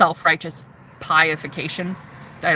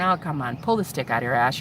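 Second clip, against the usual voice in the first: scornful put-down; 8 semitones lower, 5 dB quieter than usual. Retold or imitated. Mocking the church men.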